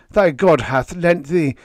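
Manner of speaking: high and loud, pleading; loud high-pitched fervent plea; crying out. Acted. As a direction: beg the bird at full voice. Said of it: "I cried".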